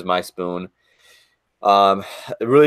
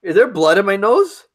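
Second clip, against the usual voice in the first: alarmed; 11 semitones higher, 5 dB louder than usual. Real, not acted. Panicked after eating hot sauce.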